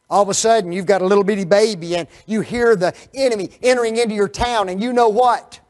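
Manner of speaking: loud, fast, rising urgency; fervent preaching. Acted. Preacher rousing the congregation with a raid scene, urgent and loud. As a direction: push the danger at them.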